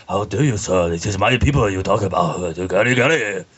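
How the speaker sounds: caricatured Japanese accent, mock indignation; hammed-up Japanese accent. Shown as a demonstration. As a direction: play offended in a put-on Japanese accent. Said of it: "I can do a Japanese".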